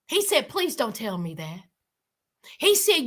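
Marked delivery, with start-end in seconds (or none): 0.5-1.6 low, soft imitation of a dad's plea; quietly beg, as a crushed father would; retold pleading. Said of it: "the dad was begging and pleading".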